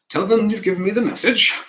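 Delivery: loud, high, insistent command; emphatic, high-pitched instruction. Acted. Rehearsing a line, first take.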